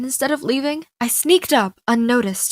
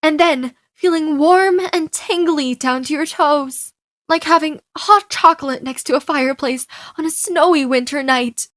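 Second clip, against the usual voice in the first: swept-up gushing, higher-pitched and building; 5 semitones higher, 4 dB louder than usual. Acted. Girl telling her friend about a kiss, gushing. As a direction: get carried away describing something wonderful.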